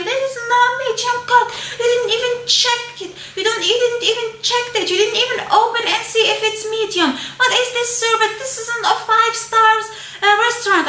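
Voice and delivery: high, fast mock scream of an angry passenger; shrill-pitched imitation of a furious customer yelling at crew; retold screaming passenger. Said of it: "started screaming and screaming".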